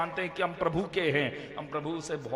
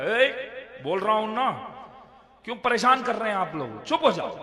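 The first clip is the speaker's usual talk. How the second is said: put-on louder, higher yell at the noisy kids; scolding shout; 5 semitones higher, 6 dB louder than usual. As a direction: raise the voice to silence the children. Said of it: "अभी आवाज मैंने ऊंचा किया". (बच्चों को ऊंची आवाज़ में डांटना)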